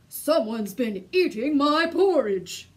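loud, surprised bear outburst; cried out in surprise. A narrator's reading. Father Bear finding his porridge eaten, loud surprise. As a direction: bellow it in alarm. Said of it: "he cried out in surprise".

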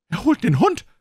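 a frightened warning to the others about the dog; alarmed cry. A narrator's reading. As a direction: shriek the danger out. Alarm as villain runs off.

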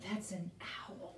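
breathy stage whisper after a gasp; hushed reveal for the children; excited whisper. Pretend. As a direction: whisper it like a secret discovery. Gasps and whispers owl reveal to kids.

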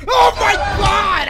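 slow, high, loud cry; shocked wail. Real, not acted. Player ran right into her, shocked wail. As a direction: drag it out in shock. Runs into enemy in game.

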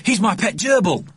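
put-on high, upset boy's protest; crying out. A narrator's reading. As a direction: plead for your pet as a distressed child. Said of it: "cried Richard".

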